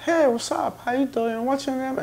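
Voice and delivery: high, bubbly mock nurse greeting; cheery nurse. Hospital nurse greeting a new patient, high and bubbly. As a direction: chirp it like a sunny receptionist.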